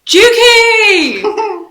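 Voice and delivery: high, loud, drawn-out cheer; hammed-up shout of a thrilled football fan; excited. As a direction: belt out the nickname like a goal celebration. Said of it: "Was there that much excitement".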